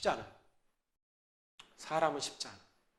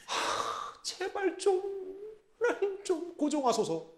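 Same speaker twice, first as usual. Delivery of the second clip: a sighing, high, soft, slow plea; pleading Moses; 10 semitones higher, 7 dB quieter, much slower than usual. Acted. Moses pleading softly with an angry God. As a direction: beg God to calm down. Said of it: "그랬더니 모세가".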